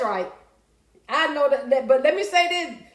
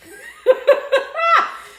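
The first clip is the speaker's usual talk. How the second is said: loud, high burst of laughter; real loud laughter; 7 dB louder than usual. Real, not acted. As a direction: crack up loudly. Laughs at her own joke.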